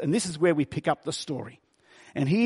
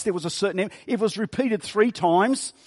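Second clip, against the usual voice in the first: ordinary talk, nothing marked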